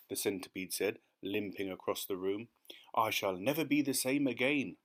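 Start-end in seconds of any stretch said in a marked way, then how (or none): none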